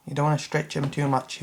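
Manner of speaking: usual speaking voice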